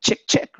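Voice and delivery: whispered chick chick like a hen; mock chicken cluck. Telling the chicken-and-pig fable to the team, whispered mock cluck. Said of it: "the chicken goes".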